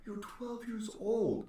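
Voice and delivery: slow disbelieving; incredulous. Retold or imitated. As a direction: spell it out in disbelief. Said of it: "I was like".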